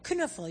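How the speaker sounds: "Knuffel" with both K and N pronounced; K sounded before N. Shown as a demonstration. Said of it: "You say the K in the end".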